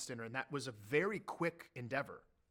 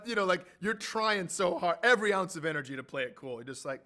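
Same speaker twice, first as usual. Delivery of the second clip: ordinary talk, nothing marked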